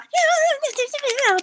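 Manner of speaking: high-pitched, drawn-out gushing imitation; mock gushing. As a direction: squeal it like someone overexcited, mockingly. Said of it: "she was gushing".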